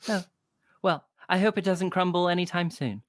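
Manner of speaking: put-on a small girl owning up to the barkeep; little girl apologizing for the bird.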